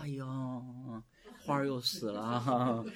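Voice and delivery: slow, drawn-out mock wail; hammed-up sighing woe; mock lament. Mocking a mournful poem before God, hammed-up lament.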